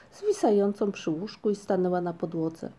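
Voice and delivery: usual speaking voice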